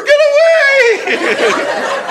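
very high, drawn-out mock scream; sissy matador shriek. Sissy matador fending off dogs, mock panicked shriek. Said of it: "like some sissy matador".

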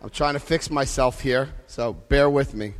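quiet and distracted, getting dressed; talking while pulling on clothes. Real, not acted. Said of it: "I'm getting dressed while I'm on stage".

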